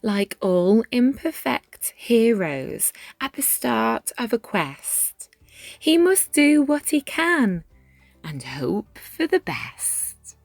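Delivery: plain reading voice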